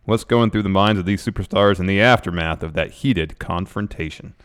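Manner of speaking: hype preview read. Acted. Reading the preview to viewers, building announcer hype. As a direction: punch the names like a hype man.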